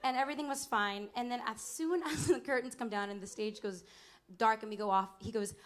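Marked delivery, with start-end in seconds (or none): none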